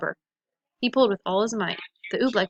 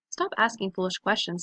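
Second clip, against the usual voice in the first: usual speaking voice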